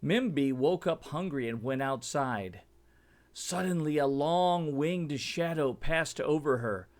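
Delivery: plain reading voice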